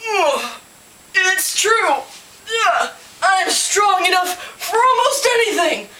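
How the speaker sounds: storybook bear showing off strength; straining boastful bear. A narrator's reading. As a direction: boast while heaving a huge log. Bear hefts log, boasting.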